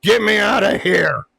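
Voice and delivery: high, loud yell at a referee; mock yelling. Wrestler Big Show berating the ref, imitated yelling. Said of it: "yelling at the ref".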